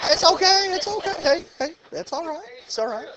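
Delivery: high, coaxing reassurance to a shy student; reassuring. Real, not acted. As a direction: soothe her, it's no pressure.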